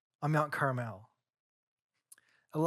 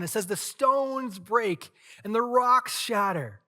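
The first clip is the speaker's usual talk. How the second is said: louder, higher preaching of the storm; dramatic preaching; 6 semitones higher, 5 dB louder than usual. Acted. Preacher paints the storm for the congregation, loud. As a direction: build the wind and quake with force.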